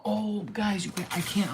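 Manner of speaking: ordinary talk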